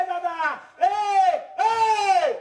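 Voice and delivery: put-on rising shout of an old woman hailing the driver; old woman calling out. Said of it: "तिने आवाज दिला". (म्हातारी ट्रॅक्टरवाल्याला हाक मारते, मोठ्याने ओरडून)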